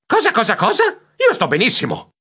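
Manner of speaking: high, startled objection; attempted protest. A narrator's reading. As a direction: protest in alarm, pitch jumping up. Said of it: "Tentai di protestare". (protesta allarmata)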